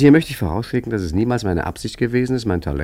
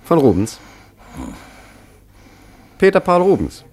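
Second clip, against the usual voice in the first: flustered; 8 semitones higher, 5 dB louder than usual. Acted. Forger flustered naming painter.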